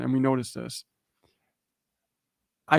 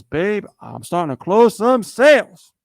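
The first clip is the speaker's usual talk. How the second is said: excited wife; 6 semitones higher, 8 dB louder, slower than usual. Retold or imitated. Wife excitedly reporting sales, retold.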